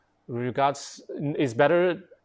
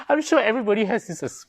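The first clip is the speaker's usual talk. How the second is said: laughing; 9 semitones higher, 6 dB louder, faster than usual. Real, not acted. Laughing at shared experience.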